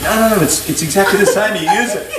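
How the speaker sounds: hammed-up dad insisting it's the same; imitating dad. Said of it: "He goes".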